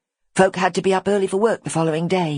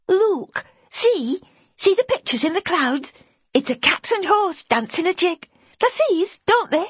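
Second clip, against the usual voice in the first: put-on high child voice pointing at the clouds; a little lad eager to share a sight; small boy, hopeful.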